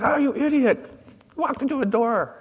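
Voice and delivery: scolding himself. Acted. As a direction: berate yourself after hitting the door. Man scolds himself after bonk.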